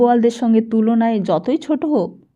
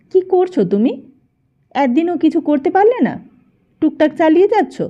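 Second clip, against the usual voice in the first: higher-pitched mild scolding; nagging reproach; 6 semitones higher, 3 dB louder than usual. A narrator's reading. Girlfriend chides her man for achieving nothing, nagging reproach. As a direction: narrator voicing a nagging girlfriend, pitch lifted. Lila nags Dhananjay in dialogue.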